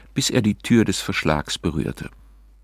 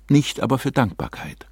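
plain reading voice

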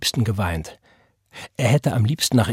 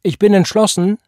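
defiant retort; 11 semitones higher, 8 dB louder than usual. A narrator's reading. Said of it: "gab sie zurück".

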